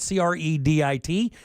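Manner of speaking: C R E D I T spelled letter by letter. Shown as a demonstration. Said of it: "It's credit".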